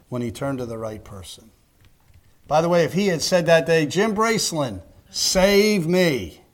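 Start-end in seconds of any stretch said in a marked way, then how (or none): 3.8-6.4 a touch of a drowning man's call, higher; mock plea for help. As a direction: call out half-joking for rescue. Peter calling on the preacher instead.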